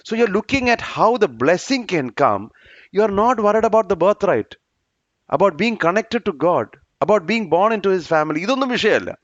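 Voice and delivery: slow, high, deliberate English sermon lines; measured preaching cadence; emphatic preaching. Acted. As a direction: lean on each phrase like a pulpit preacher. Preacher drives home the point.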